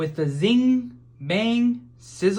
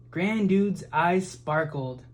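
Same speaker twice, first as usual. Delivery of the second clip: ordinary delivery, nothing marked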